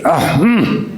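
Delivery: exaggerated sigh and yummy hum; put-on "ah, mm" of delight; savoring noises. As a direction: make a show of relishing the cookie. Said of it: "Así, haciendo sonidos".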